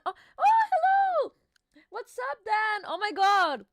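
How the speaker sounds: high, loud delighted hello to a viewer; light up as a friend arrives in chat; squealing hello to Dan in the chat; excited greeting. Real, not acted.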